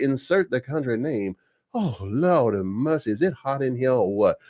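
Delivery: put-on Southern belle accent. Vlogger mocking Lindsey Graham in a hammed-up Southern belle accent. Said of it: "one of the better Southern accents you're ever gonna find".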